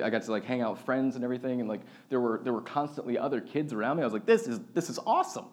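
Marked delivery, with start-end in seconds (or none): 4.2-5.5 put-on higher, wide-eyed childish thrill; light imitation of a thrilled little kid; kid delight. Seven-year-old thrilled at school.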